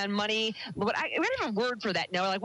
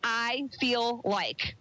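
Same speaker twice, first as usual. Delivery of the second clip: slow emphasis; much slower than usual. Shown as a demonstration. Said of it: "stop saying".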